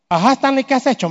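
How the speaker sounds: mock accusing question; scolding wife. Wife confronting her injured husband in hospital, mock scolding. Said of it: "me dijo".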